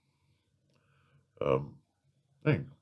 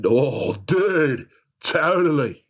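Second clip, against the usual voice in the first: mock California surfer drawl, pitched up; a hammed-up California surfer accent; surfer dude accent; 7 semitones higher, 4 dB louder, much slower than usual. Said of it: "stereotype a California accent as being a surfer dude".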